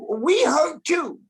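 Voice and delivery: ordinary talk, nothing marked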